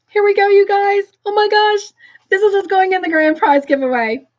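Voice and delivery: high, giddy squeal settling slightly; real excitement. Real, not acted. Host revealing the grand prize giveaway to viewers, excited. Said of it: "I am so excited".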